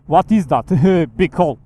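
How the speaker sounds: loud, laughing through the words; real laughter at a huge hole; laughing disbelief. Real, not acted. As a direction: crack up at the sight.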